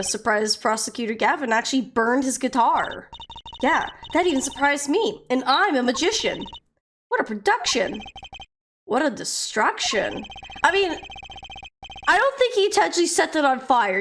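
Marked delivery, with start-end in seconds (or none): none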